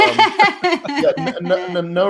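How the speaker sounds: laughing. Real, not acted. Laughing after mute mistake.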